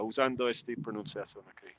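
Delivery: normal talking voice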